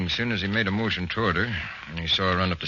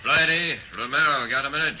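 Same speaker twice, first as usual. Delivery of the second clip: raised voice, pitched higher, calling across the room; 9 semitones higher than usual. Acted. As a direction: call them over from across the office. Chief calling detectives over.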